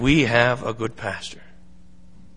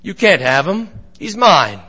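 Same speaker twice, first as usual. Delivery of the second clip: firm, louder, raised voice; protective shepherd; 4 semitones higher, 5 dB louder than usual. Acted. Shepherd warns the wolf off his lamb, raised and firm. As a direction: stand firm over the lamb. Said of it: "The shepherd says".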